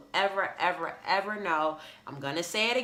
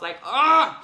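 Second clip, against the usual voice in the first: frustrated ugh; 3 semitones higher, 10 dB louder than usual. Retold or imitated. Frustrated with herself.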